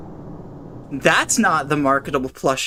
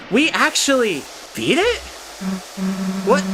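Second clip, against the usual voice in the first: surprised. Real, not acted. Disbelief after winning.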